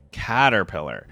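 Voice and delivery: ordinary talk, nothing marked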